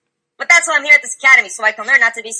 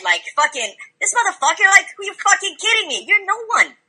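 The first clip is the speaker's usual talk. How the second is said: normal talking voice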